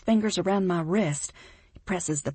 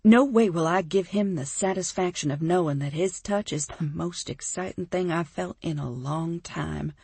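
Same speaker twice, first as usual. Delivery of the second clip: plain reading voice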